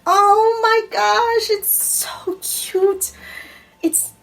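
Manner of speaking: high, slow delighted squeal; drawn-out high-pitched aww; excited squeal. Real, not acted. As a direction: gush over how cute it is. Opening a gift of socks.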